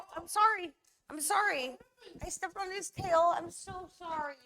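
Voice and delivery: high, loud flustered sorry; real alarm, voice jumping up; startled apology. Real, not acted. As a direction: blurt it out, shocked. Stepped on the dog's tail.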